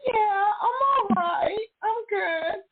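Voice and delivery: high, slow, tearful imitation; "I just cried" voice. Acting out how she answered friends, high just-cried voice. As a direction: squeak out brave reassurance as if just done crying. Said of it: "I just cried" voice".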